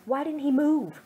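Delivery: plain reading voice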